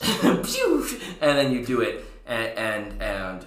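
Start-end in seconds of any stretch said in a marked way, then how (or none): 0.0-0.8 high sharp pew blast sound; mock pew. GM voicing the synapse blast at Jester, high zap sound. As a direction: zap it out like a cartoon ray gun.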